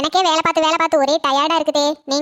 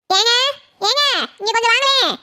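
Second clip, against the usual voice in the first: calling out; 5 semitones higher than usual. Acted. Wife with a headache summons her husband, calling out. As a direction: call across the house for attention. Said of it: "எதுக்கு இப்படி கத்திட்டு இருக்க?".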